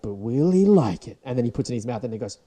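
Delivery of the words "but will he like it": suspense narrator. Retold or imitated. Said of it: "it's like".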